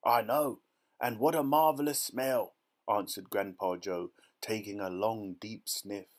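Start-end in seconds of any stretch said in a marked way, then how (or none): 0.0-2.6 loud, raised, savouring exclamation; delighted old grandfather. A narrator's reading. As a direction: exclaim with hearty relish. Grandpa Joe marvels at the smell.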